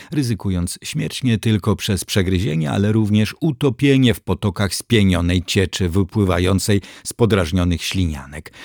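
plain reading voice

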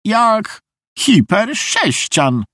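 very high, drawn-out character voice; high, slow, effortful lady; straining with effort. A narrator's reading. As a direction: stretch each word as you tug. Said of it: "pociągnięcie".

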